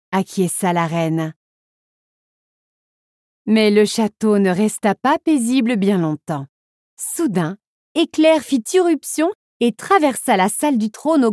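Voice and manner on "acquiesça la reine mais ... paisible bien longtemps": plain reading voice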